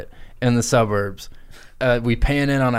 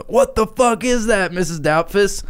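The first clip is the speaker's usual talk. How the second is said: higher, panicked family outcry; screaming family; 9 semitones higher than usual. Acted. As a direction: yell it in disbelief at the pig. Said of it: "everybody starts fucking, like, screaming".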